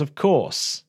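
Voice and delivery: normal talking voice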